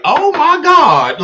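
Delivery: high, drawn-out mock squeal; gushing surprise. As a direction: gush it loud and high, imitating an excited fan. Acting out excited women's reaction.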